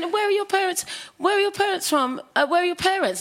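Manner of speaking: put-on high, urgent questioning girl voice; a local girl pressing with questions; mock amazed girl. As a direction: quiz her like a startled little girl. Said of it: "And she went".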